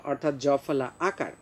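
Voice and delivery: ordinary talk, nothing marked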